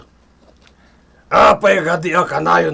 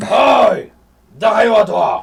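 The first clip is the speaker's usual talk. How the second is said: sudden shout, much higher and louder; angry shout; 5 semitones higher, 3 dB louder than usual. Acted. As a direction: bark at the lazy workers. Boss catches workers slacking.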